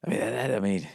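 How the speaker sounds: soft, halting mumble; a hint of a lost-for-words guest; mumbling guest. A guest fumbling for a reaction. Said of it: "And he says".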